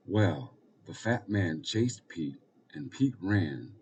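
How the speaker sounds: ordinary delivery, nothing marked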